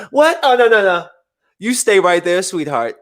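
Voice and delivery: high, loud "oh no no no" then sweetened; mock flustered woman. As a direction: scramble back to sweet talk. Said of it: "as she's dissing me".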